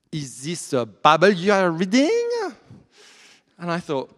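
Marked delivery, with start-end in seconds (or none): 0.1-2.5 slow, loud mock French accent, stretched out; a Frenchwoman trying her careful English. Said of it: "but with this kind of accent".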